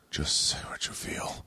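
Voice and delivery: whispered, slow, thinking it to himself; inner voice whisper. Retold or imitated. Urging the silent woman in his head, whispered. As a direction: hiss it silently inside your head. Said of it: "I'm like, I'm in my head".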